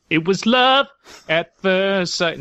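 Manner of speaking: high, loud mock swoony line, slowing down; hammed-up lovestruck gasp; mock swoon. Reading the ritual's secret words as a mock swoon. Joke punchline in ritual text.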